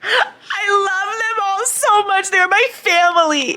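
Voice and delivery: high, slow, tearful cry of love, breaking into a shout; overwhelmed, sob it out high and slow. Real, not acted. Reader to her viewers about the characters, tearful high cry. Overcome reading book ending.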